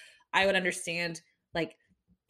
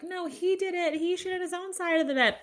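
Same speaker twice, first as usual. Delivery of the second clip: high mocking voice, lifted well above her own; mocking internet commenters; 11 semitones higher than usual. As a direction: parody the know-it-all commenters. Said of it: "some people on the internet are like".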